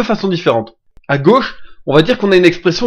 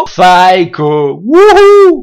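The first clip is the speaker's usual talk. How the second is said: unhinged villain cry; psycho voice; 6 dB louder, much slower than usual. Pretend. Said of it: "je fais ma voix de psycho".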